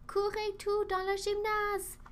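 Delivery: put-on teacher calling the children inside from the rain; exclaiming. A narrator's reading. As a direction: hurry the kids in from the rain. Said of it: "s'écria Mademoiselle Tempête".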